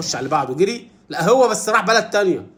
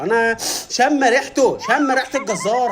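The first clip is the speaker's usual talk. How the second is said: higher, slow, drawn-out words; animal sniffing out danger; 4 semitones higher, slower than usual. Acted. Animal telling everyone it smells the butcher, drawn-out comic suspicion.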